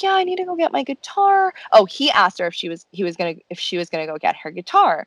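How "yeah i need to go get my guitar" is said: put-on girly high voice. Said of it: "And she was like".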